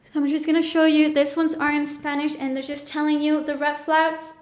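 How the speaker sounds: usual speaking voice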